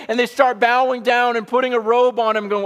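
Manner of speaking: high-pitched, urgent sermon delivery; impassioned preaching. Real, not acted. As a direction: preach it with rising fervor. Preaching at full force.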